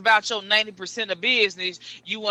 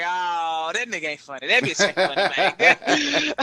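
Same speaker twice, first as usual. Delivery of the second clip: a hint of a jeering audience member, chuckling through it; mock heckler voice; 2 semitones lower than usual. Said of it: "they be like".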